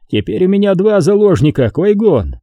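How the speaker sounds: high-pitched gloating threat; taunting villain. A narrator's reading. Xanatos to Qui-Gon, holding hostages, taunting. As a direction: press the advantage, pitch held high.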